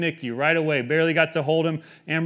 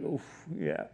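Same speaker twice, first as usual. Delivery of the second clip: quiet, offhand mock cover for tears; playing it cool; 7 dB quieter than usual. Man hiding that he cries, playing it cool. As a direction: brush it off softly, imitating nonchalance. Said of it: "I try to be cool".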